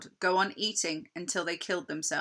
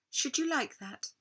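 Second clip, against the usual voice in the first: higher, sweet tempting queen; coaxing queen; 6 semitones higher, 4 dB quieter than usual. Acted. Queen tempts Edmund, high and sweet. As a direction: dangle the offer.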